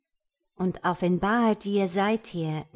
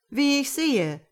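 kind, higher genie voice; friendly genie; 7 semitones higher, 4 dB louder than usual. A narrator's reading. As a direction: speak as a gentle genie. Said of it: "sagte der Dschinn freundlich".

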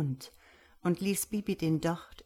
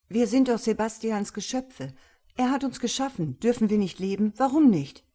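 loud, raised-pitch pleading questions; indignant protest; 4 semitones higher, 6 dB louder than usual. A narrator's reading. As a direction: build to a loud, high "why not". Bobby protestiert aufgebracht. (aufgebracht, laut und fordernd)

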